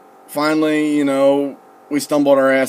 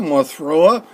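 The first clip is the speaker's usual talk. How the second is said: louder, higher, desperate retold warning; urgent warning; 4 semitones higher, 5 dB louder than usual. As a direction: raise pitch and volume, about to be sick. Repeated warning, now more urgent.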